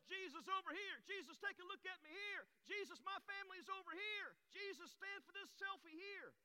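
very high mock crowd calls; acted clamouring crowd. Crowd vying for Jesus' attention, acted high-pitched clamouring. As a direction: call out for attention in a put-on high voice.

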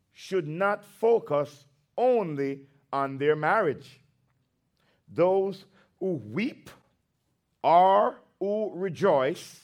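slow, measured, declaiming Bible reading; solemn slow pulpit reading; scripture reading. Acted. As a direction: read the verses slowly and weightily to the church. Reads scripture aloud.

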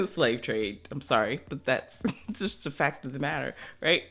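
ordinary delivery

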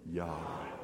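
Yahweh breathed low and soft; just breathe Yahweh out; breathed softly. Shown as a demonstration. Pastor showing how to breathe Yahweh, soft and low. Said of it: "Say it again".

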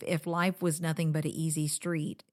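ordinary talk, nothing marked

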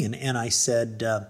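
ordinary talk, nothing marked

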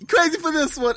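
high, loud mock hype-man cry. Echoing the joke about Ocelot's hype men, hype-man voice. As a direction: squeal it like a rap sidekick. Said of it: "just his hype, his hype men".

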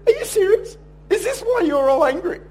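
high, loud mock disbelief; retold astonished challenge; incredulous. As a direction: pitch it right up, incredulous. Acts out disbelief at sulking colleague.

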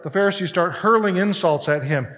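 normal talking voice

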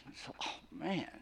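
unvoiced, breathy groan of someone just woken; groggy waking. Shown as a demonstration. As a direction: sound half asleep, jolted awake. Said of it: "have you ever been woken up like that".

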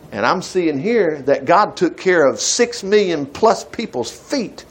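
ordinary talk, nothing marked

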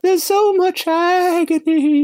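high, slow mock empath lament; mock Troi. Joining the mockery of Troi, high slow falsetto. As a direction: ham up sensing agony in falsetto. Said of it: "I feel great joy".

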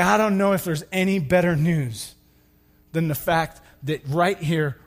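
ordinary talk

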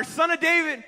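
high, loud cry for mercy. Retold or imitated. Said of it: "But he only shouted louder".

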